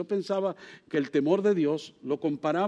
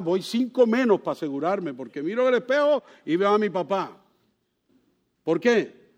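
ordinary delivery

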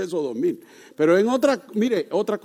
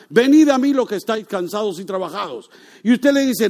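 loud, high sermon delivery building to a peak; fiery preaching; 6 semitones higher, 6 dB louder than usual. Acted. Preacher urging the young man to come to Christ, loud and fervent. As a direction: preach it hard to the congregation.

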